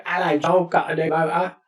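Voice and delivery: put-on rundown East London accent; demonstrating a broad East London accent. Shown as a demonstration. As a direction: slip into your East London childhood accent. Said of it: "I can do the accent I grew up with".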